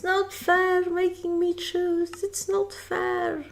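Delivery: put-on high, slow childish whine about having to choose; mock whine. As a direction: sulk like a spoilt kid.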